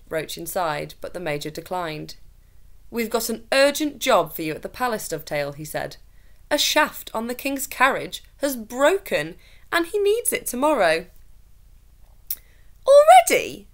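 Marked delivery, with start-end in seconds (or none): 2.9-5.5 brisk officer. A narrator's reading. Major Roach demanding urgent job. 6.5-11.1 raised, higher officer's voice, pressing; urgent officer. A narrator's reading. Officer hurrying the carpenter, urgent and loud. 12.9-13.7 sharp, high, loud disbelief; surprised. A narrator's reading. Carpenter surprised the shaft broke again, high and loud.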